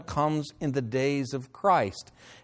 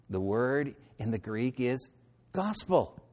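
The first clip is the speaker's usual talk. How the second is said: normal talking voice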